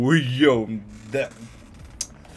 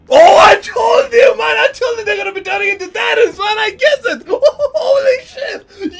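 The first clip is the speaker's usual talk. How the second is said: excited shout; 8 dB louder than usual. Real, not acted. Predicted titans, now shouting.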